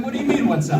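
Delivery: incredulous retort. Retold or imitated. Brother retelling his reply to a casual phone greeting, incredulous. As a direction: throw the question back in mock disbelief.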